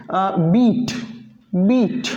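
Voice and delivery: बीट with a short ee; English word beat. Shown as a demonstration. Teacher modelling बीट for students, short ee. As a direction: say बीट crisply, ee kept short. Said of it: "spelling बन जाती है".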